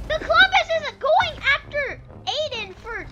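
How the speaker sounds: high-pitched, excited battle commentary; live play-by-play. Acted. Player commentating boat versus creature battle, excited play-by-play. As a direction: call the fight like a commentator, building to shouts.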